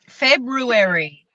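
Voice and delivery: February said slowly, every R sounded; slow February, R clearly pronounced; February with the R spelt out. Shown as a demonstration. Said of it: "have R's in them".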